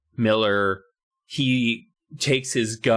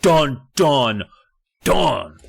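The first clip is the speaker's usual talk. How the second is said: loud, dramatic dun-dun-dun; imitated rock thuds; 3 semitones higher, 6 dB louder, much slower than usual. Narrator sounding the rock hitting his head, loud imitated thuds. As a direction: boom out each hit as its own blow. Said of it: "That was the sound of the rock".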